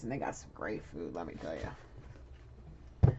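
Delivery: a slow secretive murmur about the great food; hushed low aside. Real, not acted. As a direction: drop into a low, breathy confiding aside.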